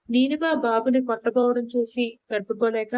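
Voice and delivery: ordinary delivery, nothing marked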